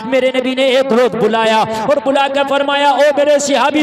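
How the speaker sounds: loud preacher's exhortation; loud sermon cry; fiery preaching. Acted. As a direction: thunder it to the crowd. Preacher at full force.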